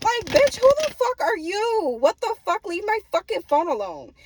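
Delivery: high, loud indignant outburst; hammed-up telling-off, stretched out; acted outrage. Acts out annoyed answer to pestering mom.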